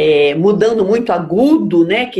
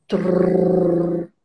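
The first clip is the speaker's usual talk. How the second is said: gentle tongue trill; 2 semitones lower than usual. Shown as a demonstration. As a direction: demonstrate an easy vibrating trill. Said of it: "bem suave".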